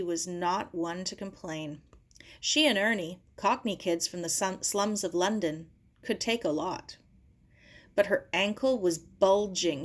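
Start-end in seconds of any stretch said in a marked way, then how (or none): none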